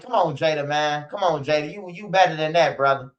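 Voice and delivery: normal talking voice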